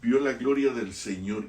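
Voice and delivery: ordinary delivery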